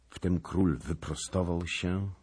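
ordinary delivery, nothing marked

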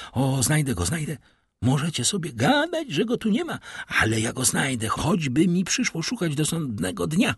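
high-pitched manic insistence; frenzied raving. Acted. Maddened father digging for his son, frenzied raving. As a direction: rave on, deaf to everyone.

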